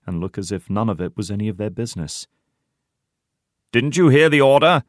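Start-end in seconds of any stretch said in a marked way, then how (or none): 3.7-4.8 high, loud, self-important demand; officious shout. A narrator's reading. Heidi pulls rank on idle comrades, officious shout. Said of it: "shouts Heidi officiously".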